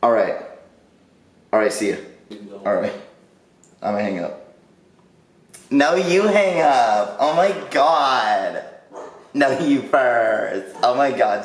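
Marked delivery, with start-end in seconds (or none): 5.7-11.4 slow, sugary lovers' phone voice; giggly late night call. Pretend. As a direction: coo it like a teen couple. Mock lovey phone goodbye.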